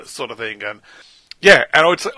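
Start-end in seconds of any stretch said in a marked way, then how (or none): none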